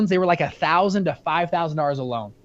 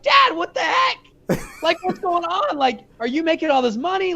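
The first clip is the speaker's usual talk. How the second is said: exasperated outburst at dad; 7 semitones higher than usual. Retold or imitated. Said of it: "I was like".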